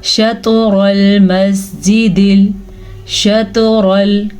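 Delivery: शतरुल मस्जिदिल chanted slowly, the द in मस्जिदिल bounced with a jolt; qalqala demo. Shown as a demonstration. Teacher showing qalqala to students, slow chanted demo. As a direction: give the sakin letters a small bounce. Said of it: "कलकला होता है पांच हरूफ पे".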